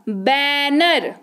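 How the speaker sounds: Banner stretched out very slowly; slow model word. Shown as a demonstration. Teacher presents banner to the class, very slow. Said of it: "अच्छा".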